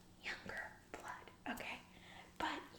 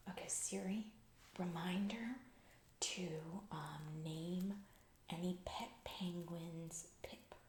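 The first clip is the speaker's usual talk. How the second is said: slow, halting dictation with drawn-out words; dictating to Siri; slower than usual. Pretend. Boss giving Siri texts to send, slow and deliberate. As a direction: spell it out piece by piece for a voice assistant.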